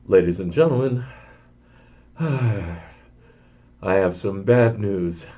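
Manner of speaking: a worried pilot over the intercom, with a sigh; apprehensive pilot. Retold or imitated. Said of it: "you could hear it in his voice, the apprehension".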